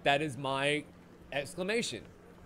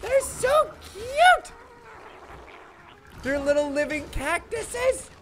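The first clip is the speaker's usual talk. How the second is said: much higher and louder, squealing; gushing delight; 8 dB louder, slower than usual. Real, not acted. Streamer gushing to chat about cactus creatures, squealing delight. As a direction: coo loudly over something adorable. Gushing over cute enemies.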